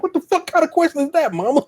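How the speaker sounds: high-pitched, incredulous challenge; mock indignant alarm. Son acting his inner reaction to mama's question, mock alarm. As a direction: play up startled suspicion in a high voice.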